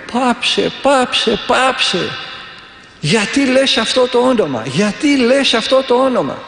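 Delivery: high-pitched, wailing imitation of a possessed man; demon howling. Demon inside a monk protests at the prayer, imitated high howl. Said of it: "αρχίζει να ουρλιάζει από μέσα μια φωνή".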